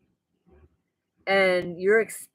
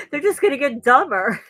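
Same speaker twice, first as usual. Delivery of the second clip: laughing; 6 semitones higher, 5 dB louder than usual. Real, not acted. Laughing at own joke.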